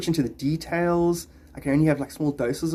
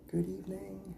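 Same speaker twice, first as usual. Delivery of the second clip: dropped, much softer hello; soft repeat; 5 semitones higher, 14 dB quieter than usual. Real, not acted. Streamer repeating the greeting to a viewer, softly. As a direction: say it again almost to yourself.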